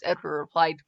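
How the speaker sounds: ordinary delivery, nothing marked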